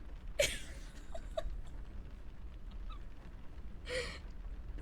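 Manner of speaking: wheezing high-pitched laugh, real; helpless silent giggle fit; real airy wheeze laugh; high breathless laugh. Real, not acted. Laughing at Crocs edit.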